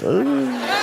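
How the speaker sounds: high strained "ugh"; cringing groan. Acted. Mom glancing at son-in-law in horror, high cringing noise. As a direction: let out a mock squeal of dread. Mom's horrified look at husband.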